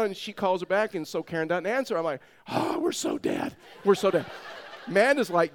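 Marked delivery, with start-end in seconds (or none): 2.5-3.5 slow, low groan of doom; hammed-up sinking realization; mock dread. Dad realizing doom, acted. 3.8-4.3 louder, higher repeat of doom; hammed-up cry of being doomed; mock alarm.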